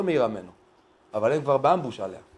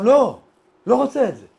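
high, raised-voice protest; acted refusal; 6 semitones higher, 5 dB louder than usual. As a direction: refuse loudly, pitched up. (סירוב נחרץ בקול רם וגבוה)

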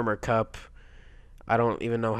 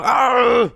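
high, held yell; big drawn-out cry; loud dismayed scream; 13 semitones higher, 11 dB louder than usual. Real, not acted. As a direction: scream as the run fails. Run fails.

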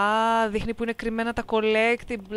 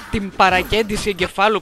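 sudden loud burst; 7 dB louder than usual. Real, not acted. Bursts out loud correcting himself.

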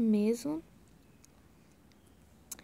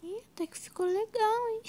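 pleasantly surprised; 10 semitones higher than usual. Real, not acted. Pleased by how the edit turned out.

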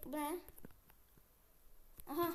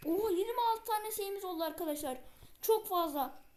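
higher and louder with every new number; thrilled, can't believe the count keeps climbing; excited counting; 5 semitones higher, 4 dB louder than usual. Real, not acted. Gamer counting iron ore for viewers, real building excitement.

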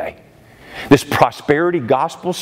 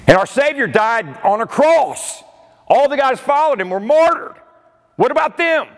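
climbing higher with each line; preacher's passion peaking on what about them; rising fervor; 12 semitones higher than usual. Real, not acted. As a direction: build the fervor up to the question. Preacher building to rhetorical climax.

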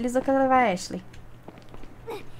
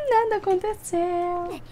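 mock dramatic; 8 semitones higher, much slower than usual. Deadpan after a failed action.

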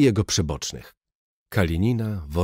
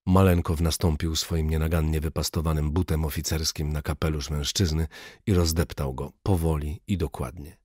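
usual speaking voice

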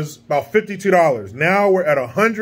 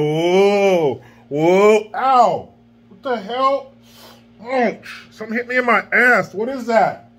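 startled astronaut; 3 semitones higher, slower than usual. Pretend. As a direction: play a floating astronaut getting hit. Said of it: "my impression of an astronaut in space".

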